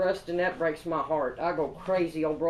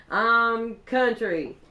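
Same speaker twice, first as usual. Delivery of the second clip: laughing; 3 semitones higher, 5 dB louder, much slower than usual. Real, not acted. Laughing proud claim of being country.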